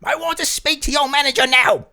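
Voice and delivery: high, loud, demanding British Karen; high British Karen complaint. Retold or imitated. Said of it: "she's like a British Karen".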